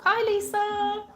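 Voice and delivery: high, loud, long-held hello; drawn-out greeting. Real, not acted. Streamer hailing a viewer who joins, drawn-out high greeting. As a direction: sing out the greeting, stretching it.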